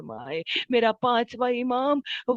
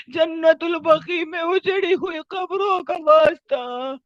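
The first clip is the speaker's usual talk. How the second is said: pleading prayer in raised, insistent cries; fervent supplication; 2 semitones higher, 6 dB louder than usual. Real, not acted. Preacher begging God by the ruined graves, fervent pleading. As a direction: implore God with mounting urgency, then ease down.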